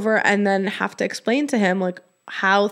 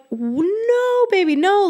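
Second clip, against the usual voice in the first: high, soothing reassurance; soothing mom; 12 semitones higher, slower than usual. Retold or imitated. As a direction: comfort the crying child, pitched way up. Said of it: "And I'm like".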